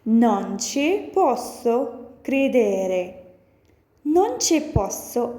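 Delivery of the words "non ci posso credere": slow model phrase. Shown as a demonstration. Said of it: "Next one is".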